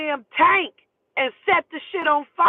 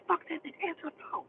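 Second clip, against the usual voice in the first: very soft, retold urging; hushed urging; 5 semitones higher, 13 dB quieter, faster than usual. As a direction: urge her under your breath. Hushed exchange as phone rings.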